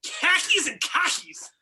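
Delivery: slow Boston accent, dropped r's. Shown as a demonstration. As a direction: drawl it like a Bostonian. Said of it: "where the name khakis and car keys came".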